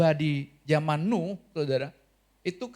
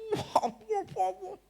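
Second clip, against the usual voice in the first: high mock amazement, much higher; amazed wah wah; slower than usual. Pastor imitating his shock at the child's home, amazed. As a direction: wah wah wah in stunned mock disbelief.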